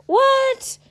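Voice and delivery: very high, loud outraged What; shocked at how ridiculous that excuse is; incredulous what. Real, not acted.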